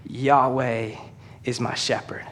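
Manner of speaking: softly, Yahweh is my shepherd said low and slow; a preacher lowering his voice to let the name land; hushed reverence. Shown as a demonstration. Preacher to church, quietly revealing the name Yahweh. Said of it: "he says".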